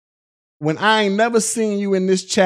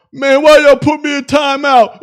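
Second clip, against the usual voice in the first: loud, hammed-up complaint, pitched up; mock whiny yell; 5 semitones higher, 8 dB louder than usual. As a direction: yell it like a sore loser put in timeout. Mock complaint about being timed out.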